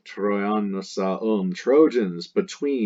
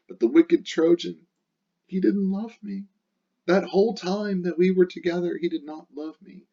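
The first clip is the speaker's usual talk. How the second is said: Dido's lament; 9 semitones higher than usual. Acted.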